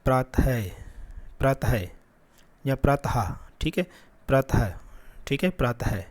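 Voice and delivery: प्रातः with a breathy h release at the end; visarga pratah. Shown as a demonstration. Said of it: "तो फिर से उपयोग करते हैं".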